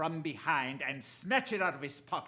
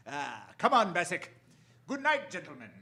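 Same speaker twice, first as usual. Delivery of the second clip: usual speaking voice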